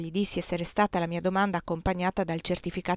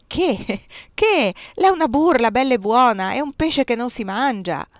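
indignant outburst; 4 semitones higher, 5 dB louder than usual. Acted. Bursar insists it's a prank.

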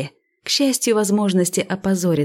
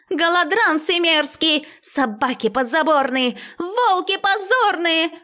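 hysterical wailing tirade; 9 semitones higher than usual. A narrator's reading. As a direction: wail insults far up in pitch. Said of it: "истошно заголосило".

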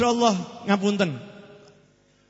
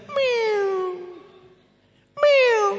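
high meow, much higher; cat meow. Retold or imitated. Preacher showing how a cat sounds, high meow. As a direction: mew like a little cat. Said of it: "Coba kalau kucing".

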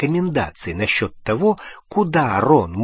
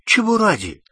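incredulous; 10 semitones higher than usual. A narrator's reading. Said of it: "переспросил Рон".

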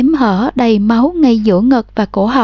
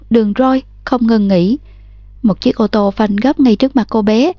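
ordinary delivery, nothing marked

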